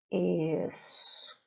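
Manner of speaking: "Escola" stretched slowly, rising and falling like a roller coaster; slow, swooping "Escola" with a lengthened middle syllable; roller-coaster word melody. Shown as a demonstration. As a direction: draw out "Escola", gliding over a long co and down. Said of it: "como se fosse uma montanha russa".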